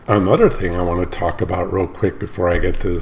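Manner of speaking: ordinary talk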